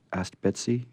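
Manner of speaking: plain reading voice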